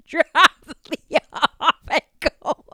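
squeaky laugh on no air; laughing so hard no air left. Real, not acted. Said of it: "completely silent laughed".

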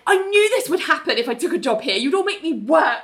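high, quick mock outrage; indignant complaint. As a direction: whine about being made to work. Ling's acted complaint about work.

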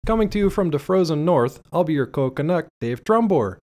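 loud, high-pitched podcast welcome in a bad Canadian accent; terrible Canadian accent. Host opening the show for listeners, terrible Canadian accent. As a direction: lay on a hammed-up Canadian accent. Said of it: "That is a terrible Canadian accent".

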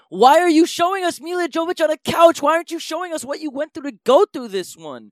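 loud, high-pitched indignant questioning; worked-up, incredulous complaint; exasperated rant. Acted. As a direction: demand answers in comic outrage. Mock rant at the film's villain.

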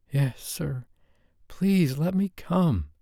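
high, slow pleading woman's voice; pleading lover; begging. A narrator's reading. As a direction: beg slowly, submissively.

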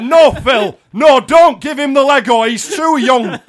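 hammed-up mum shouting her protest. Mum shouting at Phil not to give Lego, mock. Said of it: "this would be my mum".

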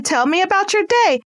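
your lifted high and bright; eager. Shown as a demonstration. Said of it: "Oh man".

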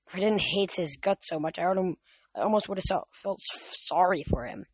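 ordinary delivery, nothing marked

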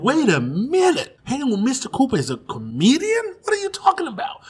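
can't believe Mr. Cooper does stand-up; mock astonished shout; shocked disbelief. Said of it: "Then I was like".

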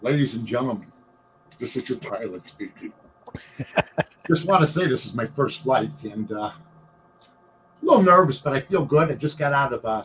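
low pilot over the intercom; pilot announcement. Pretend. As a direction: speak like a nervous rookie pilot. Pilot announcement on airplane bit.

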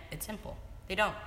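usual speaking voice

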